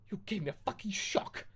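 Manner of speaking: high mock-angry shout; startled teen snapping at Hagrid; shouting angrily. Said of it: "I shouted angrily".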